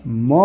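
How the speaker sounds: "म" as muh, not em; phonic sound of M. Shown as a demonstration. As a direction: sound out "म" low, with a neutral vowel. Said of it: "एमचा उच्चार आहे".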